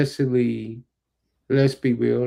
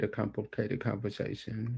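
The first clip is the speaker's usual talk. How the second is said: softly spoken answer; too quiet; 11 dB quieter than usual. Real, not acted. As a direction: answer softly, without projecting. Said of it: "you gotta speak up".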